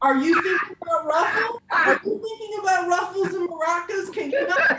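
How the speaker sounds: loud, high-pitched mock outrage; mock accusation. Comic accusing audience of picturing ruffles, loud mock outrage. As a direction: shout it at them like a scandalized accuser.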